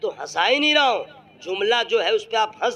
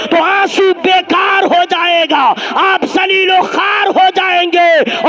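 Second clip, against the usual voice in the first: impassioned shouted preaching; 10 semitones higher, 12 dB louder than usual. Real, not acted. Preacher shouting to the gathering about tears, impassioned sermon voice. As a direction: proclaim it as a fervent preacher.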